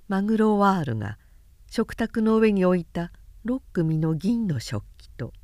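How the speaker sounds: ordinary delivery, nothing marked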